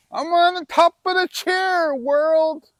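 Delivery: high, loud triumphant yell. Shown as a demonstration. Vlogger on his high chair, yelling out triumphantly. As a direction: shout it from the top of the chair. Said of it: "Have you ever yelled that?".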